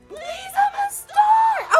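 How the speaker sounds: very high, drawn-out theatrical cry; desperate shout. Acted. Teacher acting out a plea for stardom, desperate shout. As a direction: wail it slowly, hammed-up and pleading.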